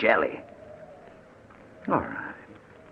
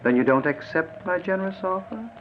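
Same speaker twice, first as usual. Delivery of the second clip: much higher, sneering taunt; mocking menace; 8 semitones higher, 4 dB louder than usual. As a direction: toy with him cruelly, pitched up. Kidnapper mocks and threatens.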